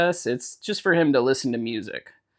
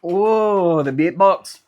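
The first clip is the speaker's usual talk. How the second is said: put-on Irish accent; 6 semitones higher, 6 dB louder, slower than usual. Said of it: "that Irish accent".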